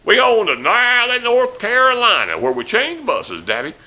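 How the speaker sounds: high, loud mock blowhard announcing to the whole bus; loud bragging voice. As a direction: belt it out so the back row hears. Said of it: "No, he's going".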